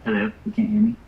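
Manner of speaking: muffled mic, asking if heard; muffled voice through a pillow. Real, not acted. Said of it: "speaking into a pillow".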